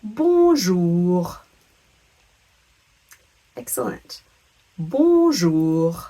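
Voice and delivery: "Bonjour" slowly and loudly for repetition; drawn-out teacherly "Bonjour"; French pronunciation demo. Shown as a demonstration. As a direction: model French "Bonjour" with silent n. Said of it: "just repeat after me".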